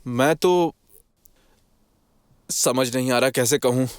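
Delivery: halting, with a long pause after the opening; hesitant faltering. Acted. Accused man avoids telling where he was, faltering. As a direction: falter as if unsure how to say it. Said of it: "तुम हकला क्यों रहे हो?".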